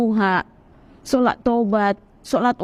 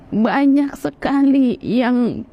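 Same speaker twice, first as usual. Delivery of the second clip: normal talking voice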